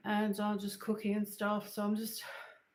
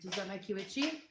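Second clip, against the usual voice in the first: ordinary talk, nothing marked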